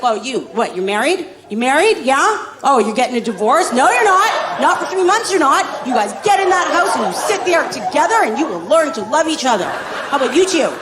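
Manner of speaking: sarcastic dad punishing the whole world; mocking stepdad Dale. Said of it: "He's like".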